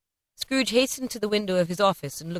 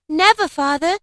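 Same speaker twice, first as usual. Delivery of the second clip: put-on higher, louder children's reply; children assuring their father, pitched up; crying out together; 10 semitones higher, 7 dB louder than usual. A narrator's reading. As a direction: answer your father eagerly. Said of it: "cried they all".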